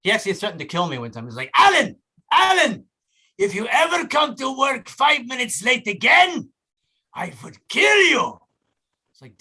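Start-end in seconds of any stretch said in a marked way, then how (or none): 1.6-8.4 high, loud, slow shout in a hammed-up Hungarian accent; angry Hungarian boss. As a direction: threaten like an irate Hungarian employer. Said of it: "a guy who was Hungarian".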